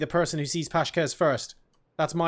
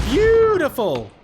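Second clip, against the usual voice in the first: loud, high, drawn-out cheer; exultant cry; 11 semitones higher, 6 dB louder, slower than usual. Real, not acted. Caster cheers Double's frag grenade, exultant. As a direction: stretch the first syllable high and loud, then fall.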